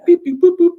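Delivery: high, loud electronic beeps; keypad beeps. Retold or imitated. Recapper imitating the doctor's safe, piercing beeps. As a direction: mimic punching in a safe code.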